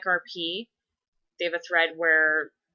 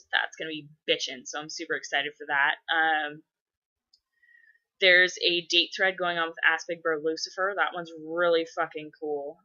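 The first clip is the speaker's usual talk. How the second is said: usual speaking voice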